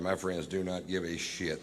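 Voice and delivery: usual speaking voice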